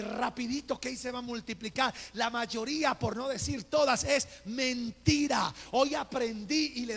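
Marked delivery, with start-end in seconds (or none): none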